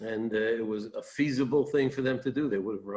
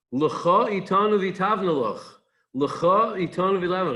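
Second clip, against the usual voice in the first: urgent pleading; 6 semitones higher, 4 dB louder, slower than usual. Retold or imitated. Moshe repeatedly urges Yisro.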